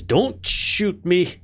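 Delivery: high, slow, acted plea; mock-frightened character warding off an attack; alarmed plea. Character pleads with feared spellcaster.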